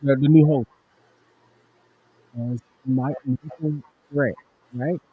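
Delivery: muffled, turned away from mic; talking off to the side of the mic. Real, not acted. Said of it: "you sound muffled".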